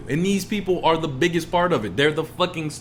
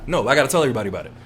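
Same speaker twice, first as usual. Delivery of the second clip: usual speaking voice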